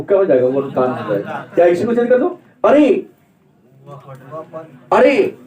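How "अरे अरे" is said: sharp exclamation of surprise; raised pitch on a surprised outburst. Shown as a demonstration. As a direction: demonstrate a short, startled exclamation. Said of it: "आश्चर्य".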